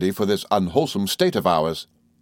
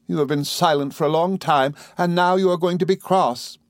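put-on higher, plaintive young wife voice; childlike wife Dora, pouting; 8 semitones higher than usual. A narrator's reading. Wife accusing husband of crossness, high and pouting. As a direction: complain in a high, sulky little voice.

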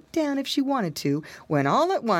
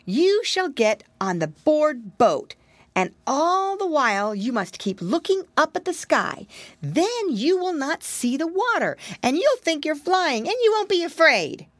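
higher, lightly voiced little pig; wary piggy boy musing to himself; lightly raised boyish piglet voice; piggy boy thinking; 8 semitones higher than usual. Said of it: "he cried".

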